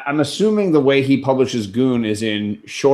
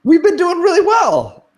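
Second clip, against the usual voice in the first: defensive protest; 5 dB louder than usual. Real, not acted. Defensive protest, high pitch.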